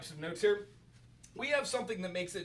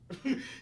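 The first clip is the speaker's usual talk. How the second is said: short laugh; 9 semitones higher than usual. Real, not acted. Laughs at own demo.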